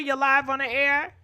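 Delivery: ordinary talk, nothing marked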